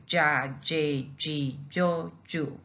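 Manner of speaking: "ja, J, G, Jo, Ju" as ja, jeh, jee, jo, joo; j sound on each of "ja, J, G, Jo, Ju"; double L drill. Shown as a demonstration. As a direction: drill "ja, J, G, Jo, Ju" syllable by syllable. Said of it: "and we pronounce it ja".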